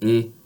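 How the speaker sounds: "e" as a pure tense ay; steady closed "e", no glide; French accent aigu vowel. Shown as a demonstration. Teacher demonstrating accent aigu, pure closed "e". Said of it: "you pronounce it".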